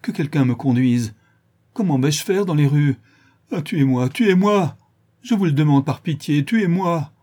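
desperate begging, rising high and loud; pleading. Acted. Schoolmaster begging to be killed, pleading. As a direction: beg wildly for pity. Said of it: "d'un air suppliant".

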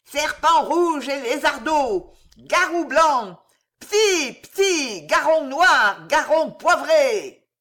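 high, loud, slow yelping incantation; a furious crone shrieking a spell; high loud screeching witch chant. Acted. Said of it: "Elle se mit à glapir".

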